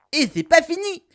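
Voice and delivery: high, loud catchphrase burst; excited announcement. Acted. Catchphrase announcing yet another weapon. (annonce enthousiaste, aiguë et forte)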